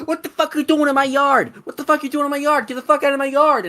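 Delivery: high, loud mock yell at a trespasser; hammed-up angry homeowner screaming; imitating angry lady yelling. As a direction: shriek it like a furious neighbour. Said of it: "some lady pops out of everyone and goes".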